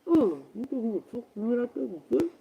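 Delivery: slow, soft, smothered speech; muffled voice. Shown as a demonstration. Showing exactly how the ghost sounded, muffled and indistinct. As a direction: imitate words too muffled to make out. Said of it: "That's exactly how it sound".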